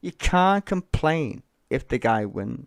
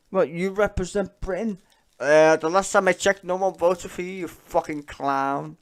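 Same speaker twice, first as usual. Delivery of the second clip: louder, higher scornful tirade; angry rant; 6 semitones higher, 6 dB louder than usual. Real, not acted. Streamer ranting at a politician he calls a clown, angry. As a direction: tear into him, slowing on the insult.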